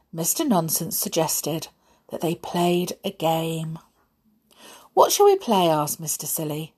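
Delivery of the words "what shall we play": high bright asking; eager question. Acted. Mister Silly asking about a game, eager. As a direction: ask eagerly.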